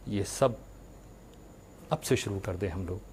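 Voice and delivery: ordinary talk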